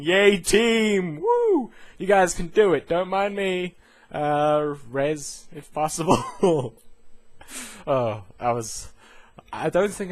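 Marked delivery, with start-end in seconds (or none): 0.0-6.2 high, loud mock cheerleading; corpse cheering. Dead player cheering the raid team from the floor, loud and high. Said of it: "I was just a corpse going". 6.3-7.8 high burst of laughter. Real, not acted. Vlogger cracking up at own joke, high laugh.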